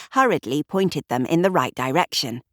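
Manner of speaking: ordinary delivery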